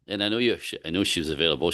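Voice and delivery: normal talking voice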